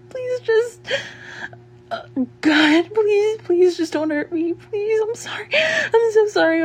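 high, tearful apology; panicked, tearful begging; frightened pleading. Acted. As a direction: beg desperately, pitch jumping up. Begging an attacker not to hurt.

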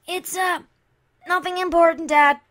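loud, high nervous call. Acted. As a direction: call back to the next room, flustered. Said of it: "she called back".

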